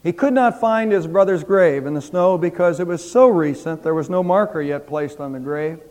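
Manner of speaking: ordinary delivery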